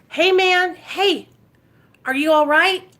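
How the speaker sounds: high, loud call across the street. Retold or imitated. Calling from her car window to a nodding stranger, loud call. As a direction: call out to get his attention. Calling to a man at bus stop.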